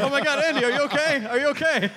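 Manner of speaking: mock alarm. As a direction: play concerned for the screamer. Mock alarm after the yell.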